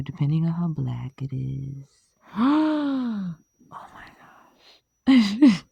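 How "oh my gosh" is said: breathy whisper then a burst; gasp, then blurt it out; sudden realization, gasp and exclaim; gasped oh my gosh. Real, not acted. Sudden gasp mid-vlog.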